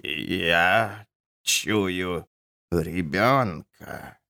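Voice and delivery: low, soft, drawn-out trembling; quavering voice. A narrator's reading. Lady speaks from the stone altar, trembling. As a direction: let each slow word quiver eerily. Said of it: "трепещущий голос".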